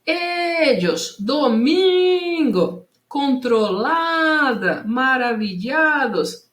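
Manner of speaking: slow call, holding E, MIN, LA, LLA; stressed syllables stretched. Shown as a demonstration. Teacher showing pupils the strong syllable, each word called out long. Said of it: "A sílaba que mais se alonga".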